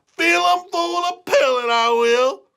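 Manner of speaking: high country farmer ready to shoot; excited old farmer. Acted. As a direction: holler like a riled-up farmer.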